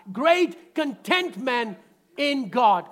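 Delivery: slow, loud, punching GREAT; call it out loud. Shown as a demonstration. Preacher leading the congregation to shout it. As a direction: proclaim it to the room, slow and strong. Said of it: "Come on, shout it out".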